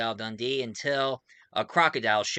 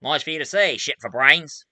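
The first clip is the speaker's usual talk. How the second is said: louder, mock-indignant retort; Crocodile Dundee; 2 semitones higher, 7 dB louder than usual. Hero answers troublemakers calling him a poacher, loud mock retort. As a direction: throw back the insult, loud and punchy. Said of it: "and he says".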